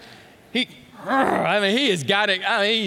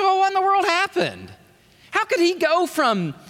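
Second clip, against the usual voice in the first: incredulous question; 11 semitones higher, 4 dB louder than usual. Acted. Preacher voicing onlookers' puzzled question.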